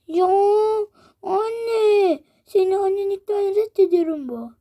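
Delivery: put-on high, tearful kid wail; mock crying. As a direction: whine at mom through fake tears. Çocuk ağlayarak isyan ediyor. (annesine ağlayarak kızan çocuk)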